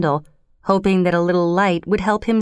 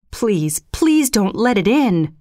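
fearful plea; slower than usual. Acted. Terrified husband begging his wife to keep the door shut, pleading. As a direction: beg her not to open the door. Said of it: "cried the old man in fear".

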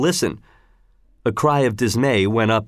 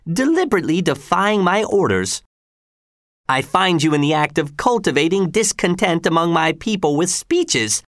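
higher-pitched, lightly voiced imperious governor; governor Gessler; 6 semitones higher than usual. Governor justifying his challenge to Tell, lightly imperious. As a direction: order and lecture with self-righteous impatience.

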